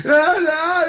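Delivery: high, whiny comic sob; mock crying. Crying friend answering his compadre, mock crying. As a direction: pretend to blubber. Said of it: "venía llorando y dice".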